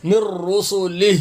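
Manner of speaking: "مر رسلہ" slow, stopped on the h; vowelless final h. Shown as a demonstration. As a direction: recite "مر رسلہ" pausing on a breathed h. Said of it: "ہا ساکن ہو جائے گا".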